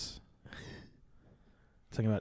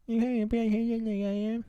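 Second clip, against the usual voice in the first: much higher put-on cartoon voice; squeaky character voice; 11 semitones higher, 3 dB louder, faster than usual. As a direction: pitch way up into a silly character. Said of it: "this is your thing".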